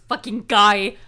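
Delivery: loud exclamation landing hard on GUY; imitated yell. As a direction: yell it in mock exasperation. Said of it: "like, yell".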